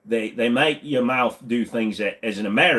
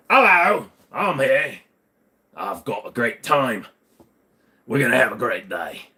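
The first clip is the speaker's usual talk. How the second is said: hammed-up Cockney greeting; cheery London Cockney voice for the chat; Cockney accent. Shown as a demonstration. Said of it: "Yes, Cockney".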